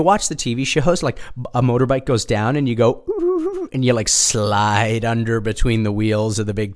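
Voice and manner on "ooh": mock ooh. TV-show crash reaction, mock gasp.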